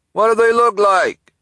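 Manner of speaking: high, loud call across the store; delivery man calling back across the store. Acted. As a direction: shout it back over the aisles. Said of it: "the delivery person called back".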